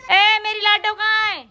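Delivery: high, loud, drawn-out call for a little daughter. Real, not acted. Calling out for her daughter.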